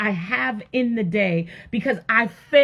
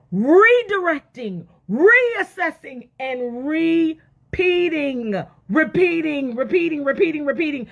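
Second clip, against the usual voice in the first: REDIRECTING, REASSESSING, REPEATING stretched out high, then repeated quickly; exasperated repetition; 7 semitones higher, 4 dB louder, slower than usual. Shown as a demonstration. Wife owning up to nagging her husband, exasperated repetition of REPEATING. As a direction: hammer REPEATING over and over, high and insistent.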